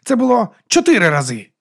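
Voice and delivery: high, loud confession; exclaimed. A narrator's reading. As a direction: blurt it out loudly. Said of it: "а потім вигукнув".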